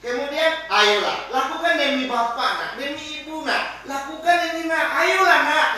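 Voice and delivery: high, pleading coax; teacher demonstrating the buddy stance, coaxing; coaxing friend. Shown as a demonstration. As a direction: beg the child sweetly to try. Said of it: "Ini sebagai apa? Teman".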